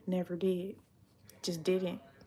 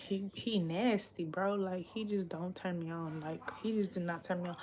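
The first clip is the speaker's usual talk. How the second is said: ordinary talk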